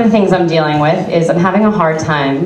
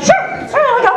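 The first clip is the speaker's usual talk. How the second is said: breezy high agreement. Retold or imitated. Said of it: "I'm just like".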